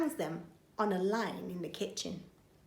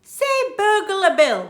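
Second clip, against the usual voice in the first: high, loud coaxing burglar; coaxing Burglar Bill; 11 semitones higher, 12 dB louder than usual. Acted. Burglar coaxes baby to say his name. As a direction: coax the baby to say it. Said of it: "says the baby".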